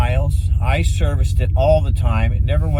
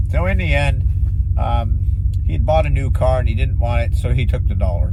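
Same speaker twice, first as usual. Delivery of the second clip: ordinary talk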